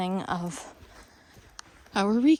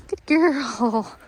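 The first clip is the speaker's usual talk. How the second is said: happy praise; 5 semitones higher, 8 dB louder than usual. Real, not acted. Trainer praising her dog as it turns, higher and louder. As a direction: cheer the dog on.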